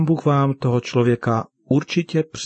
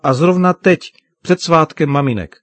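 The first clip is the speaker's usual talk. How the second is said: high, loud acted indignation; hurt daughter protesting, voice raised; upset reproach; 6 semitones higher, 7 dB louder than usual. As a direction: throw it at him as an accusation. Upset daughter reproaches her father.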